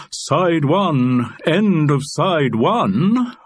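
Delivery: slow, grandly swooping stage delivery; actorish. Acted. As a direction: declaim it like an over-theatrical actor. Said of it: "without sounding actorish".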